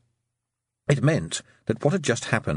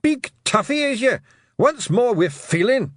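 high, loud Scots taunt; pugnacious, pitched way up; tiny fighter's shout; 14 semitones higher, 5 dB louder than usual. A narrator's reading. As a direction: yell it like a little brawler. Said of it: "shouted the tiny fighter".

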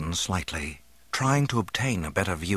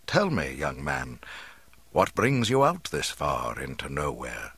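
put-on lower-pitched, measured old man's voice; kindly old man; 6 semitones lower than usual. A narrator's reading. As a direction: question a young man with calm authority. Old man questions the youth.

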